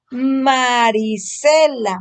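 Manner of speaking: very slow, every syllable of Marisela stretched out; slow reading demo. Shown as a demonstration. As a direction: drag Marisela out slowly for a child to copy. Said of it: "Otra vez".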